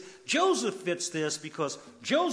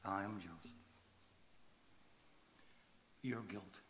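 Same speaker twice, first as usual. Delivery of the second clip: hushed reveal; 5 semitones lower, 16 dB quieter than usual. Acted. Preacher bringing Joseph's reveal home to the crowd, hushed and low. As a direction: drop it to a heavy near-whisper. Quiet retake after pause.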